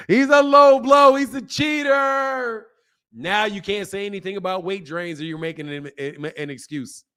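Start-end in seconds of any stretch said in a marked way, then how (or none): 0.1-2.7 high, slow mocking wail; mock outrage. Imitating a sore fan complaining about Andre Ward, loud mock outrage.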